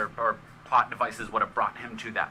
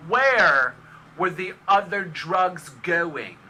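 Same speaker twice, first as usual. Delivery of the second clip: high, slow drawn-out Malkovich; John Malkovich impression; 7 semitones higher, 6 dB louder, much slower than usual. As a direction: stretch it out, odd and drawling. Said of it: "Or John Malkovich".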